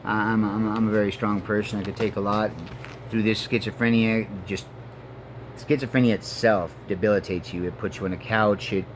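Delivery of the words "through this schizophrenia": ordinary talk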